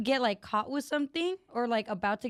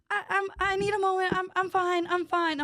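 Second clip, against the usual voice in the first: high-pitched mock insistence; imitating upset girlfriend; 7 semitones higher than usual. Retelling the girlfriend walking away from him, high mock-upset voice. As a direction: imitate a woman insisting as she walks off. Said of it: "as she's walking away, she's going like".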